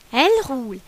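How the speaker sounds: ordinary delivery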